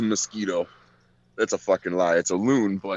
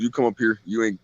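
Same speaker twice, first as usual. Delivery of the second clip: ordinary delivery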